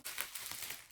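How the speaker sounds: quick unvoiced gasp of delight; sharp excited gasp. Real, not acted. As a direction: gasp in surprise. Gasps at sunglasses.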